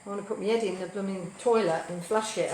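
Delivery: ordinary delivery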